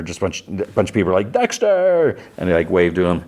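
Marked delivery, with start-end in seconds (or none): 1.3-2.2 high, bright call of his name; cheerful group greeting. Retold or imitated. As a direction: call out happily like the party crowd. Crowd greets Dexter at wake.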